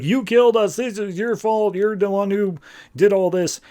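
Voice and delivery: high-pitched mock wail of blame; lightly hammed-up ghostly accusation; accusing ghosts. Accusing ghosts in retold scene.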